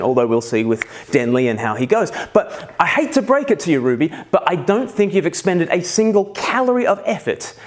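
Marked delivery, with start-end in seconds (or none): 2.3-7.5 mock scolding. Mock telling-off of his daughter.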